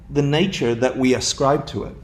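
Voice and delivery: ordinary delivery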